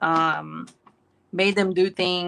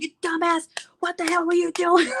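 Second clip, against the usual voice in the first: much higher, hammed-up scolding; mock scolding; 11 semitones higher than usual. Mom scolding the kid who wasn't hit, mock anger. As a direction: tear into the kid, half laughing. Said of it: "you're like".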